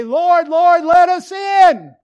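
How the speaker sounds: loud, high, pleading cry at the door; desperate shout. Acted. Locked-out virgins pounding the door, desperate shout. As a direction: shout it, begging to be let in. Foolish virgins shut out, begging.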